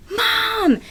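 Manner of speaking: high-pitched scream of Mom; retold loud scream for mom; screamed really loud. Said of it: "I screamed really loud".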